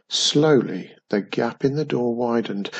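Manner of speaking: ordinary delivery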